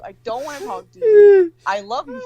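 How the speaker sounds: exasperated whine, half crying. Real, not acted. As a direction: let it crack like mock sobbing. Venting about calling mom.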